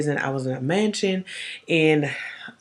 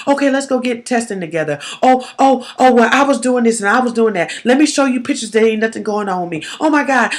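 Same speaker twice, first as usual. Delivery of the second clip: mocking flustered partner; 7 semitones higher, 6 dB louder than usual. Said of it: "now you being a slave".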